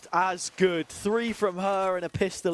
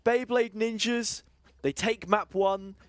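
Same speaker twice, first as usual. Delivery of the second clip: high, punchy esports call; caster call; 6 semitones higher than usual. Acted. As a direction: call the map win with energy. Caster calls the result.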